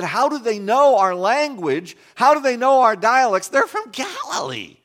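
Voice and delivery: an astonished crowd asking in disbelief; preacher voicing the crowd's amazed questions at the apostles. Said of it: "And they said".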